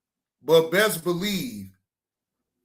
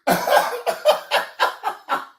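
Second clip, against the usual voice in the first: high laugh; 3 dB louder than usual. Real, not acted. Laughs at dog.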